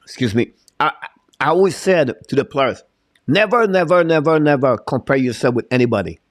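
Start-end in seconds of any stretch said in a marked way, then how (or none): 3.3-6.2 a fired-up coach hammering never; emphatic repeated never. Real, not acted. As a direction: drive each never home like a coach. Coach hammering advice to players.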